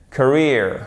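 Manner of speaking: Career as kuh-REER, stressed second syllable; correct English pronunciation. Shown as a demonstration. Teacher confirming after learners' turn, correct English pronunciation. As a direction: model Career clearly with English r. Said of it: "À vous".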